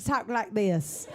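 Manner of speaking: lightly imitated hillbilly twang; hillbilly accent. Retelling how a teacher's students talk, hillbilly accent. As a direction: mimic little hillbillies' country accent. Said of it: "they all sound like little hillbillies".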